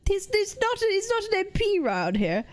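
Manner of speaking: high-pitched mock British accent; mimicked British accent. Mimicking the clip just played.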